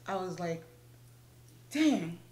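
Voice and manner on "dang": loud, clipped exclamation of annoyance; annoyed dang. Retold or imitated. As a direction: spit out the word in irritation. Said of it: "I was pissed".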